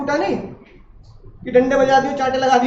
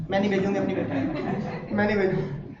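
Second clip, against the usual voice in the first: softer, half-laughing imitation of a tearful mother; mock crying mom; 4 semitones lower, 7 dB quieter than usual. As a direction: play the weepy mom refusing, lightly. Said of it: "mummy रोने लगे वहाँ". (रोती mummy की हल्की नकल)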